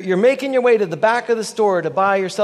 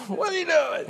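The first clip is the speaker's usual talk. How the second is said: rude Texan shopper; 9 semitones higher than usual. Retold or imitated. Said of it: "in a Walmart in Texas".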